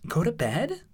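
high, surprised echo question; high-pitched puzzled surprise. A narrator's reading. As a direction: query it with pitch shooting up. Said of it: "Twilight almost flinched in surprise".

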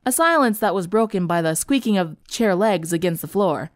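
usual speaking voice

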